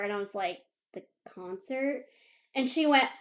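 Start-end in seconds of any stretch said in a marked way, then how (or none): 0.9-2.0 softer, questioning reply; tentative answer. Retold or imitated. Telling friend what the shoes are for, tentative. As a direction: answer as if it were obvious, questioningly. Teller's puzzled answer, retold.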